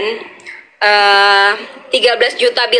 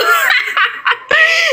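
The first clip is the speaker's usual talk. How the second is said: high bursting giggle; real laugh. Real, not acted. Fan amused that others copied her pose, high giggle.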